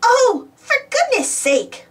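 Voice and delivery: high, drawn-out exasperated scoff. A narrator's reading. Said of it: "the giant scoffed".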